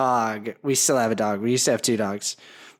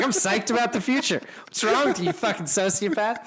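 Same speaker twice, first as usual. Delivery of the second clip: high, giggly fake outrage; laughing mock scold; 6 semitones higher than usual. Real, not acted. Host laughing as he mock-scolds his cohost. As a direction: scold him in jest, laughing.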